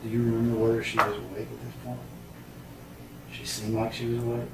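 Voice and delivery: usual speaking voice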